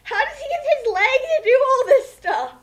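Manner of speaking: high, loud question broken by laughter; laughing question. Real, not acted. Asking the others about the fanfic, laughing hard and high. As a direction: ask it while cracking up.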